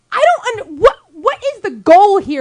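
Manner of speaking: exasperated yelling. Retold or imitated. Retelling her blow-up at the dump worker, exasperated yelling. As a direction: raise your voice in frustrated disbelief. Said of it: "now I'm yelling".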